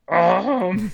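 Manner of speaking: put-on high, drawn-out drunken um; acting drunk. Drunk friend told he won't get a lift, exaggerated drunken dismay. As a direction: stretch it out like a hammed-up drunk. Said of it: "Academy Award for acting, like, drunk".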